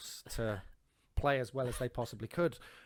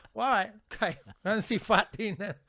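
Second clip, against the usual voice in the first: higher, louder mock resignation; exasperated; 8 semitones higher, 7 dB louder than usual. Friend dragging him to Fat Dean, exasperated. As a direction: give in, mock fed up. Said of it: "I'm like".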